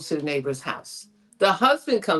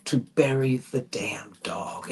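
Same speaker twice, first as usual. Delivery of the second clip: whispered aside; 6 semitones lower, slower than usual. Retold or imitated. Aside while retelling the digging.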